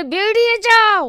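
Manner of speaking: high, loud, slow shout. Acted. Officer shouts Boltu out of the room, high, loud and slow. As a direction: yell him out the door. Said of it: "officer আরো চিৎকার করে".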